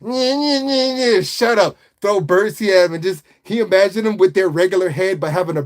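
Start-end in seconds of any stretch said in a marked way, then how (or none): 0.0-1.8 put-on high, nasal-free childish nyeh nyeh; mocking taunt. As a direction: taunt like an annoying bird. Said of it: "annoying, just like".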